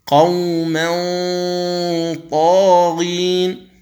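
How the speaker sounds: قوما طاغين drawn out slowly, tanwin hidden in a heavy nasal hum; ikhfa recitation. Shown as a demonstration. Teacher showing tanwin before ط, slow nasal recitation. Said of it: "التنوين".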